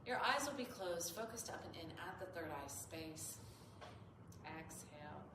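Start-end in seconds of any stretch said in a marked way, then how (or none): none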